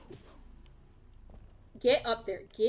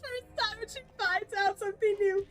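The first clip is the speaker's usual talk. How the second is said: much higher, loud laughing gush; excited squeal; 10 semitones higher, 5 dB louder than usual. Real, not acted. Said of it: "one of the most excitable people".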